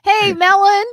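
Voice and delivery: loud high playful call of the nickname; teasing nickname call. Real, not acted. Teasing her cohost, a loud high playful call. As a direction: tease him by shouting the new name.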